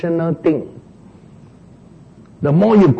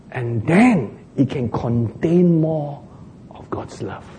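hushed whisper; 5 dB quieter, slower than usual. Real, not acted. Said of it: "hushed ending of sermon phrase".

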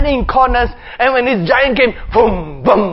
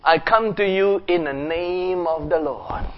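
softer, slow steady reply; calm brave boy; 5 semitones lower, 8 dB quieter, slower than usual. Acted. David answering Goliath, calm and slow. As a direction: answer with quiet courage. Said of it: "David says".

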